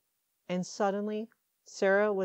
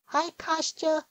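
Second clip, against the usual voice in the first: put-on little girl voice; 12 semitones higher, 5 dB louder, slower than usual. A narrator's reading. Small girl greets happily.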